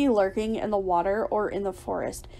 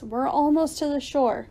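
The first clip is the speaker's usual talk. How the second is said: higher-pitched encouraging call; shouted encouragement; 4 semitones higher than usual. A narrator's reading. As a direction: urge them on to the shore. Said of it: "I shouted".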